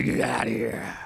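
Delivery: deep command. Acted. Jesus ordering the demon out, very low and stern.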